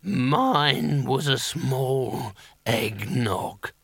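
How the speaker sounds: very slow, drawn-out sickly character voice; Pestilence. A narrator's reading. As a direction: stretch each word out slowly. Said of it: "said Pestilence".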